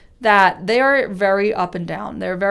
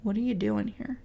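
soft voice; 9 dB quieter than usual. Shown as a demonstration. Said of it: "That little voice that says".

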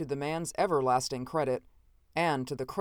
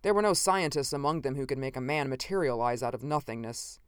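ordinary delivery, nothing marked

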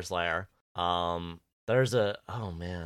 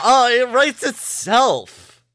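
excited; 13 semitones higher, 12 dB louder, much slower than usual. Real, not acted. Gushing about an imagined episode.

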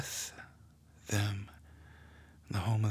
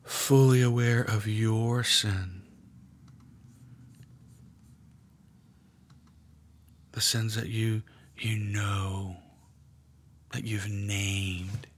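guided meditation. Acted. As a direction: draw them gently into the scene. Leads a guided prayer meditation.

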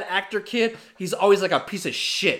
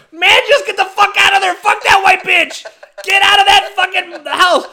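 high, loud shout at the screen; yelling at the screen; 9 dB louder than usual. Retold or imitated. Moviegoer yelling at the screen, high and loud. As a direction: holler high and loud at the hero. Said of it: "people were doing in the fucking movie theaters going".

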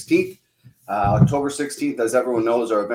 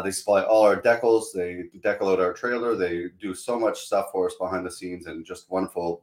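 normal talking voice

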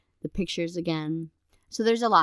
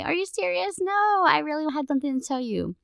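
much higher, incredulous squeal of disbelief; mock shock; 10 semitones higher than usual. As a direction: act out shocked disbelief at a friend's no. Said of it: "I'm gonna be shocked and I'm gonna say".

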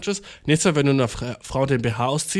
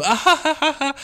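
high fake laugh; 12 semitones higher than usual. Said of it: "ihre Freundin so richtig schnell".

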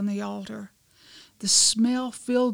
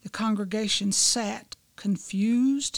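normal talking voice

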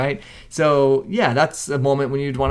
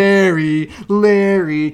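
drawn-out taunting call from the stands; mock heckling; 4 semitones higher, 4 dB louder, much slower than usual. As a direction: stretch the name into a jeer. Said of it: "like, calling him".

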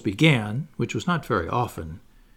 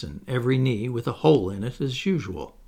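usual speaking voice

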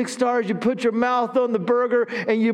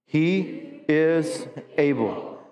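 each word drawn out slowly; slow emphatic; 5 semitones lower, much slower than usual. Shown as a demonstration. Pastor modelling the phrase for the church, slow. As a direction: stretch it out for them to repeat. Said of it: "Say that with me".

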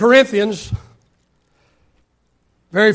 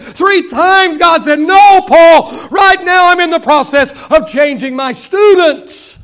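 rising, louder preacher's cry; preaching at full force; 8 semitones higher, 7 dB louder than usual. Acted. Preacher rousing the congregation, full preaching force. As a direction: build it up like a revival preacher.

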